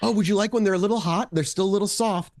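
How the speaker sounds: lightly voiced friendly mom; welcoming mom. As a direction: offer treats like a doting mother. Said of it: "They welcome you in. The mom's always".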